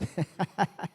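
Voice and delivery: soft, real chuckle; short chuckle. Real, not acted. Preacher sees one vote against all, amused chuckle. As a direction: chuckle briefly.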